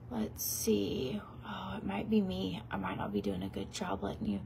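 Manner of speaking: breathy hushed whisper while filming. Real, not acted. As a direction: whisper so he won't hear.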